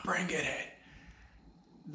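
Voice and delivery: slow secretive whisper. Real, not acted. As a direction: draw them close and whisper it slowly. Leaning in to the audience.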